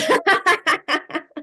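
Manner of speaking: high delighted laugh. Real, not acted. Laughs at George's caveat.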